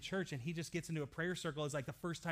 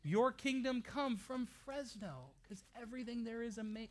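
mock prayer; 5 semitones higher than usual. Shown as a demonstration. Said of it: "no, it's not".

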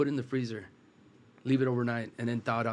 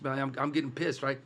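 normal talking voice

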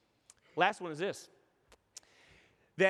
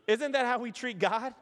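normal talking voice

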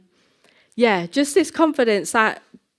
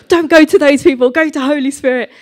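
loud, higher mock preaching; emphatic exhortation; 5 semitones higher, 10 dB louder than usual. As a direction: call it out as a joking warning. Mocking her own heavy preaching.

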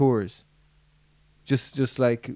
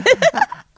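high giggle; 8 dB louder than usual. Real, not acted. Giggle during the accent attempt.

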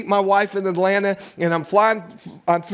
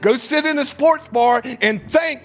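raised, urgent sermon voice; preaching at full force; 5 semitones higher than usual. Acted. Preacher warning young men, raised and urgent. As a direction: press the warning home to the congregation.

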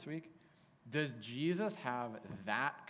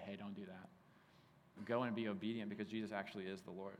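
gentle exhortation; 5 semitones lower, 8 dB quieter than usual. Real, not acted.

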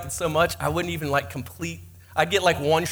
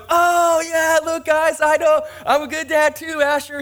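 much higher and louder, boasting proudly; proud shouting; 10 semitones higher, 4 dB louder than usual. Retold or imitated. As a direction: show off the decorations to the family. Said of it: "Griswold, I'm like".

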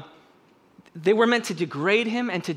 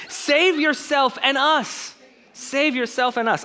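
high, loud taunting shout; loud sneering taunt; jeering yell; 10 semitones higher, 4 dB louder, slower than usual. Acted. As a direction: yell it up at him as a mocking criminal. Criminal yelling insults.

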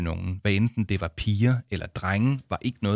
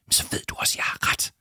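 insistent whisper; hushed, voiceless argument; faster than usual. Acted. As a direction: whisper it as a firm point. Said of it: "hviskede Jama".

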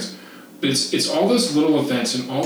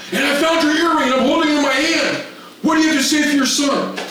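high, louder, formal report voice; mock-official director; 3 dB louder, faster than usual. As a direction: play the stern official reporting to a parent.

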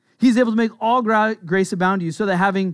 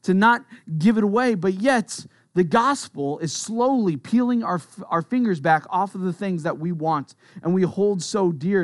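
ordinary talk, nothing marked

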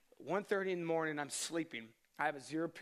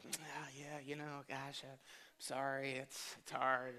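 much softer, put-on consoling tone hiding his delight; mock sympathy; 2 semitones lower, 5 dB quieter, slower than usual. Picking her up after the breakup, hammed-up softer sympathy. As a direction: fake condolences, trying not to grin.

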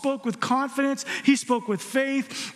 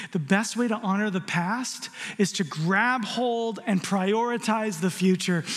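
ordinary talk

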